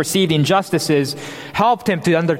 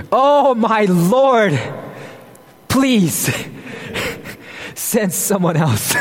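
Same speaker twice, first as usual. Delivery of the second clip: a slow, drawn-out mock plea; reluctant Moses pleading; slower than usual. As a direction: play a reluctant Moses begging off, half-laughing. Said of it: "He says in verse thirteen".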